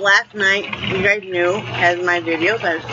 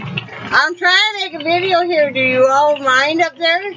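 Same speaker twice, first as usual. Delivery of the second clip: raised voice; 6 semitones higher than usual. Real, not acted. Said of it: "You can hear the thunder".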